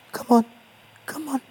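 high coaxing call to a pet; high-pitched coaxing voice. Acted. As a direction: coax it in, high and sweet. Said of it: "why are you speaking in a high-pitched voice".

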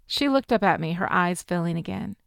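plain reading voice

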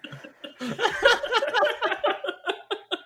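high wheezy laugh; high burst of laughter. Real, not acted. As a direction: crack up laughing.

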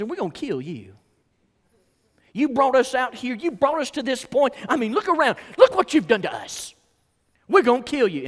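high, loud acted accusation; mock-furious quarrelling; angry threat. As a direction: round on the leader like an angry mob. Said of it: "they even threaten Moses".

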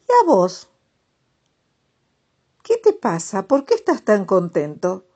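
curious asking. Acted. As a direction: ask eagerly, amazed. Other toads ask, surprised.

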